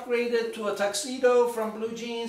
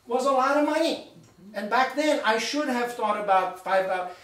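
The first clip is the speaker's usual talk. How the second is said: louder and higher toward the end; rising excitement; 7 semitones higher, 4 dB louder than usual. Real, not acted. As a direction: build up to the punchline. Realization building to punchline.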